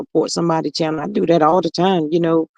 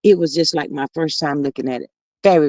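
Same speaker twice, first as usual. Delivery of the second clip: normal talking voice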